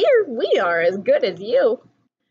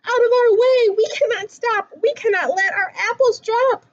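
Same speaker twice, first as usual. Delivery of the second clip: frantic story reading; 10 semitones higher than usual. A narrator's reading. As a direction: raise the alarm as the bear chases them. Frantic chase in picture book.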